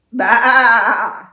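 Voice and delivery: loud bleat from the rooster; sheep bleat. Acted. Storyteller voices the rooster bleating at the farmer. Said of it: "the rooster looked at him and went".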